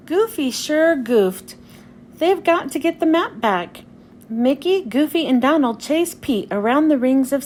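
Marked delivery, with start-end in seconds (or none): none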